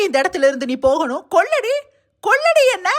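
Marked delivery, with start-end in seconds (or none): 1.3-2.9 high, loud furious challenge; furious dare. Acted. Sister-in-law dares Vidya to kill her, shouting. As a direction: scream at her to go ahead and kill.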